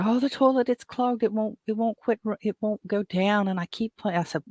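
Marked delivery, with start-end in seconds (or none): 0.0-3.5 higher, flustered retold voice; lightly voiced panicky woman; frantic woman.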